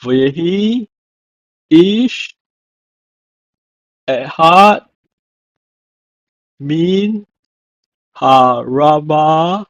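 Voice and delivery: careful Hebrew phrase, word by word; slow Hebrew verse reading. Shown as a demonstration. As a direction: read each Hebrew word apart, slowly. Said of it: "from First Samuel one one".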